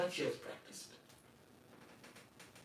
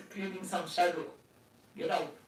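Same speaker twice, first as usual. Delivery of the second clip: ordinary talk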